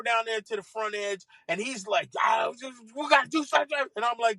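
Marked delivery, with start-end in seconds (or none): 2.2-3.8 agitated golfer, retold; worked-up urging, pitch climbing; jacked up. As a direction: imitate someone too wound up to settle. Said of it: "he's still jacked".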